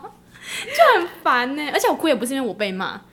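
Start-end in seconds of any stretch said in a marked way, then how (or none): 0.0-1.1 laughing. Real, not acted. Laughing exasperation after running upstairs.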